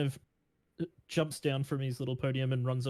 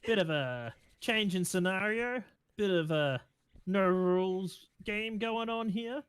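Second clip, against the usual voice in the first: raised, crowd-hyping announcer voice; fighting game announcer; 6 semitones higher than usual. Acted. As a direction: announce it to the whole arena. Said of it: "runs back over and goes".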